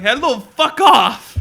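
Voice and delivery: mock angry shout. Telling a telemarketer off, hammed-up shout.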